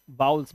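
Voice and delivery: वाउल्स as "VAA-ools", low-pitched; correct pronunciation of vowels. Shown as a demonstration. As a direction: say वाउल्स with no v in the middle. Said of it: "वावेल नहीं". (वाउल्स का सही उच्चारण, "वा-उल्स")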